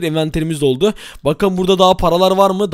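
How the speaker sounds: normal talking voice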